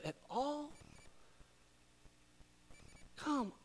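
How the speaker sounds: soft, slow intimate urging; hushed appeal. Real, not acted. Preacher quietly presses congregation to give. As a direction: lean in and plead quietly.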